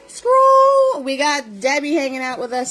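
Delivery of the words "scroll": high, loud one-word call. Real, not acted. Host calling out loud for the chat to scroll.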